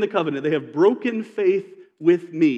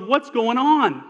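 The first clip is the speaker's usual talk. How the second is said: exasperated question; 6 semitones higher, slower than usual. Retold or imitated. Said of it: "and he goes".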